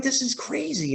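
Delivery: like you can't believe your eyes; stunned disbelief. Retold or imitated. Recalling Cody's match in front of him, stunned high disbelief. Reliving disbelief at the live event.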